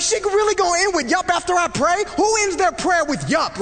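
loud, high and incredulous; can't believe she said that; indignant outrage. Retold or imitated. Husband fuming after his wife ends the prayer with yup. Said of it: "saying yup is the worst thing".

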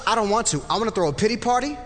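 usual speaking voice

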